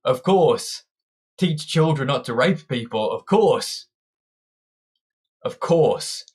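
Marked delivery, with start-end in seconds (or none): none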